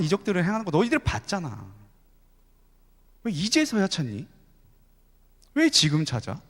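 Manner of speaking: Jesus gently scolding his disciples; reproachful Jesus. Acted. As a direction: ask why they didn't look to him. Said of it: "그것을 책망하신 것입니다".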